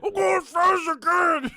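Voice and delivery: high, slow strained shout; high, loud, slow frantic dad; mock shout. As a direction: yell it high and drawn out. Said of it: "dad quote continued".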